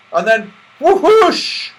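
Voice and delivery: high, loud, slowed whoosh; dramatic whoosh. A narrator's reading. Narrator telling Santa's burst from the trapdoor, dramatic. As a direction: land it with a big splash.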